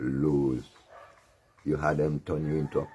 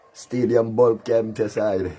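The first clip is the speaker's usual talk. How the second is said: normal talking voice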